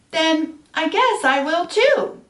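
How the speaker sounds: high, slow, cheerful pollywog; light and glad, a touch excited; happy. A narrator's reading. Pollywog happily deciding to change too, cheerful. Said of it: "the pollywog said happily".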